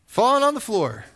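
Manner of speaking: ordinary talk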